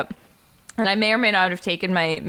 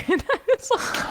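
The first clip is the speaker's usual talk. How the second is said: laughing; 12 semitones higher than usual. Real, not acted. Laughing at drunk Edelman.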